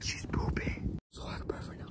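hushed whisper. Real, not acted. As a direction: breathe it out unvoiced. Whispering while dog poops.

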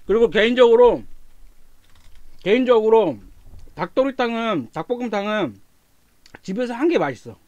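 speaking between bites; talking while chewing. Real, not acted. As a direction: mouth partly full of food. Seen 1: eats food with chopsticks during the clip, mouth working between phrases.

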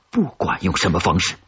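usual speaking voice

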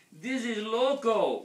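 high, loud, drawn-out wail. A narrator's reading. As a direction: wail it out slowly in dismay. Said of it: "wailed Scipito".

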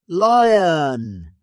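loud, high drawn-out call. A narrator's reading. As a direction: call out to someone at a distance. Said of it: "she called out".

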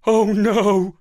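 loud, high panicked howl; howling in dread. A narrator's reading. Dog terrified of cat people, loud howl. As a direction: wail it like a doomed dog. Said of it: "I howled".

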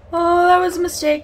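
sudden loud, high alarm; alarmed outburst. Real, not acted. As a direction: blurt it in fright. Spotted by the alien.

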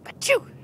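sudden high spoken achoo; put-on sneeze. Pig with no jacket in the cold, put-on sneeze. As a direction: burst out with a high mock sneeze. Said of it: "I sneezed".

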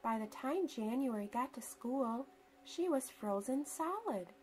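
ordinary delivery

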